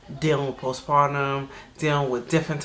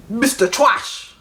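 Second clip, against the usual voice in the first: scornful name call; 6 semitones higher, 3 dB louder, much slower than usual. Acted. Renaming him scornfully.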